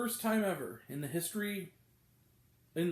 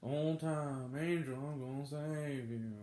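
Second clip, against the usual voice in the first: slow, quieter mock southern drawl; bad southern accent; 3 semitones lower, 5 dB quieter, slower than usual. Reviewer mimicking the male lead's acting, bad southern accent. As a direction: do a badly faked, dragged-out southern accent. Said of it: "trying to do a southern accent".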